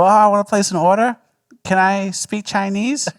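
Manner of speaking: high, loud put-on caller voice; prank caller. Retelling a friend's restaurant prank, hammed-up caller voice. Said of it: "And then he would go".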